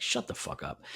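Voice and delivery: low, near-voiceless retort; hushed put-down. Retold or imitated. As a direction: drop it under your breath. Retold curt put-down.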